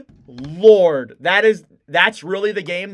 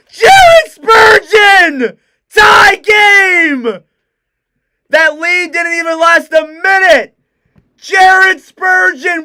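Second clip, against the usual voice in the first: slow, stretched, cracking high shouts; screaming goal call, voice cracking; 11 semitones higher, 11 dB louder, slower than usual. Real, not acted. Commentator roaring the tying goal, voice cracking. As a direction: scream the scorer's name until the voice breaks. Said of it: "craziest voice crack ever".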